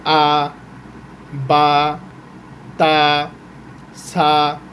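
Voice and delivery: আ, বা, তা, সা each said alone with long pauses; letter sounds read. Shown as a demonstration. Teacher showing students how to read letters, one sound at a time. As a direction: sound out আ, বা, তা, সা one by one, not spelled. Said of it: "reading পড়া".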